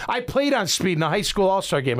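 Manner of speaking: ordinary delivery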